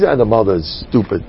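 ordinary delivery

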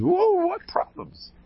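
high drawn-out whoa, teasing; mock surprise. Rabbi mocks a friend who complains, high and teasing. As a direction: act amazed anyone has problems.

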